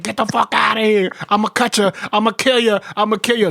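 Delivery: half-playful rage while chasing him with a knife; mock furious threat. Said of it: "I was like".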